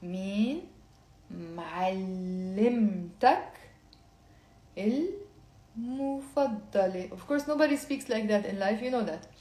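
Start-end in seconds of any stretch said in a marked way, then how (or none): 0.0-7.1 slow, drawn-out syllables for learners. Shown as a demonstration. Teacher reading it slowly for the class to repeat. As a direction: spell each word out slowly. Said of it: "I'll say it very slowly and then faster".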